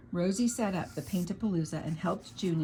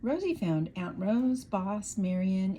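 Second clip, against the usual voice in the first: ordinary delivery, nothing marked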